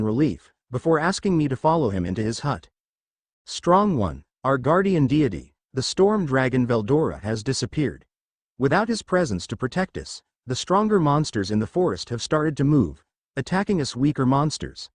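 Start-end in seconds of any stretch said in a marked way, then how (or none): none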